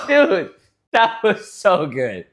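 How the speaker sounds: high, loud, drawn-out gushing; delighted praise. Real, not acted. Brother raving about sister's story. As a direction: rave about how good it was. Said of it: "praising the story".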